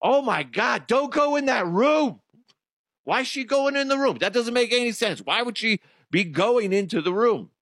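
a moviegoer hollering at a horror film; yelling at the screen. Retold or imitated. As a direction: shout at the character on screen. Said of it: "be like".

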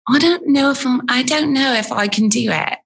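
tentative put-on Queen's English accent. Shown as a demonstration. Answering if she can do it, Queen's English. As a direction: have a go at proper Queen's English. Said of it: "Queen's English".

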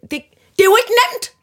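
hammed-up frustration at the system; exasperated complaint. Shopper complaining about scan-and-pay shops, acted exasperation. Ranting at self-checkout shops.

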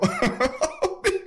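sudden high-pitched laugh of disbelief. Real, not acted. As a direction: burst out laughing, incredulous. Incredulous laugh at corrupt deal.

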